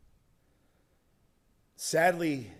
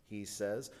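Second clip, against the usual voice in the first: ordinary talk, nothing marked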